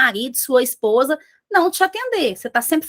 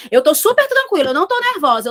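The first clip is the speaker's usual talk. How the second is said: louder, higher protest of being calm; mock agitation; 4 semitones higher, 4 dB louder, faster than usual. Shown as a demonstration. Trainer showing that tone must match words, mock agitated insistence.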